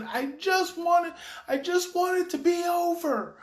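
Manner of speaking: put-on high, slow child's wail; worked-up child. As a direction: imitate an overwrought child pleading. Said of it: "he's elevated".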